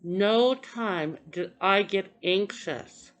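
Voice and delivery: "no time" slow and weighted; stress on no time. Real, not acted. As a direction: hit "no time" hard and slow. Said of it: "this is what I wanna stress".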